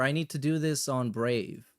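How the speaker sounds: ordinary talk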